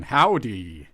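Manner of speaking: hammed-up Texas howdy; mock Texas accent. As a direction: tease with a big fake Texas hello. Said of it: "doing my Texas accent".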